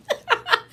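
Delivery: sudden high laugh; high burst of laughter. Real, not acted. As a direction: laugh out loud, pitched high. Laughing at the doctor's order.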